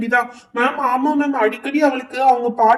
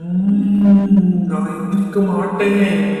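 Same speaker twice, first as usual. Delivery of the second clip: lower, slow, eerie possessed voice; terrifying ghost voice; 4 semitones lower, 4 dB louder, much slower than usual. Acted. Possessed girl refusing the teacher in a low, slow ghost voice. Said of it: "அதை ஏன்டி இவ்ளோ டெரிஃபிக்கா சொல்லிட்டு இருக்க".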